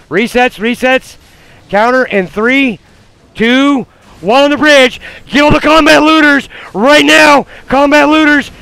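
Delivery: high, loud urgent yells; frantic shouting. Real, not acted. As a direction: scream orders at the team. Calling a counter push.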